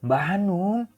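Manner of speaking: loud cry. A narrator's reading. Hana suddenly yells to her cousin, loud cry. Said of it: "dia berteriak keras".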